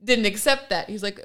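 usual speaking voice

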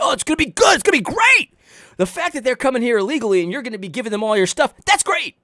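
high, sing-song false cheer; mock cheery pastor. Mocking Duncan's upbeat message, high and sarcastic. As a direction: oversell it sarcastically. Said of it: "While saying".

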